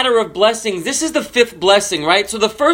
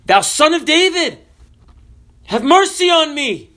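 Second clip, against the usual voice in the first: crying out more insistently; 9 semitones higher than usual. Retold or imitated. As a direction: plead higher, over those hushing you. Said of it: "he cried the more a great deal".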